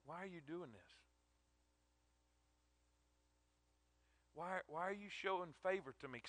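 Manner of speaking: hushed, soft wonder at the kindness; humbled question. Acted. Ruth on her knees asking Boaz why, hushed. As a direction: ask it almost under your breath.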